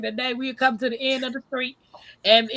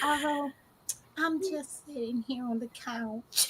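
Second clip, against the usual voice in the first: much softer, hushed answer; quiet whisper-like reply; 11 dB quieter, slower than usual. Retold or imitated. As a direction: hush it like Miss Alice. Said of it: "I whisper".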